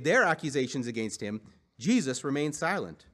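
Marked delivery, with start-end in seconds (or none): none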